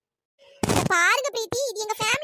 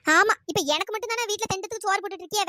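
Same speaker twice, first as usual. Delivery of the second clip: usual speaking voice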